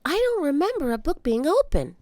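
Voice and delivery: put-on high little girl, puzzled. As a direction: wonder aloud like a kid. Annie voiced by narrator.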